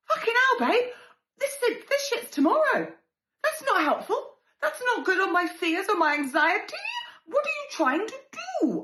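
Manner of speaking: high, loud frantic complaint; exasperated panic. Retold or imitated. Snapping at her husband the night before, high frantic. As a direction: squeal it at him, rattled and cross.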